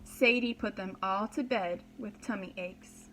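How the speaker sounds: usual speaking voice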